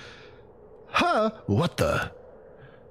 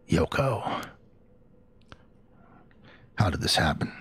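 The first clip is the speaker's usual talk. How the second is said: lightly lowered, a hint of a gruff soldier; Solid Snake voice; 7 semitones lower than usual. Narrating the hero's grief as Solid Snake, lightly lowered. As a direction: tamp the voice down like a grim soldier. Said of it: "I forgot I was doing Solid Snake voice for him".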